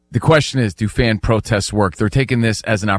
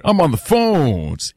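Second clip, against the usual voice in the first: slow, higher, smooth buttery imitation; Danny G; 4 semitones higher, slower than usual. Host imitates his producer on the phones, smooth and buttery. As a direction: stretch it out in a teasing smooth radio voice. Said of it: "He's got the smooth, buttery voice".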